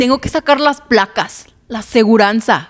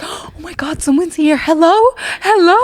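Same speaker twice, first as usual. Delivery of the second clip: startled calling out; 8 semitones higher, 3 dB louder than usual. Retold or imitated. Friend startled on stairs, calling out.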